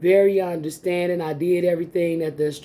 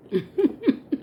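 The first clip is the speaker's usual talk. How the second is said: light rising giggle; a short playful giggle; 10 semitones higher than usual. Real, not acted. Encouraging viewers, ending on a playful giggle.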